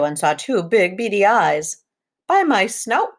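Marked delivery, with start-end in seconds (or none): 2.3-3.2 high, loud piglet cry; pig's startled high cry at the window. A narrator's reading. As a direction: yelp it out high like a scared little pig. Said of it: "cried Horace".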